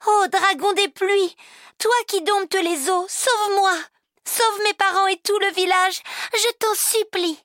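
high, loud pleading cry; desperate cry. A narrator's reading. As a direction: cry out and beg for the village. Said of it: "et elle s'écria".

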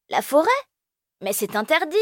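high surprised cry on forêt; shocked little boy; exclaiming. Acted. Elio shocked at the forbidden forest plan. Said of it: "Elio s'exclame".

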